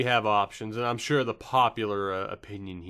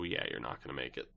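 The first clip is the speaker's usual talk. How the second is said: lower, softer and quick throwaway; muttered aside; 9 semitones lower, 10 dB quieter, faster than usual. Real, not acted. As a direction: mutter it fast under your breath. Dismissing Chicago's playoff chances.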